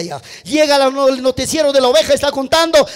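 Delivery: ordinary talk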